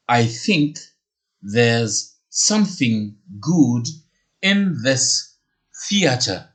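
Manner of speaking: there's something good drawn out slowly; slow repeat for learners. Shown as a demonstration. Teacher slowly repeating the bonus phrase for students. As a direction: spell it out slowly so they can follow. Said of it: "la phrase bonus que je vous donne".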